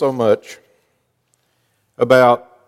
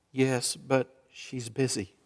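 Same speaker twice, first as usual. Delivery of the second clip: put-on much softer, a small kid's whisper; hushed little boy; child whispering; 3 semitones lower, 13 dB quieter, slower than usual. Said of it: "Still whispering".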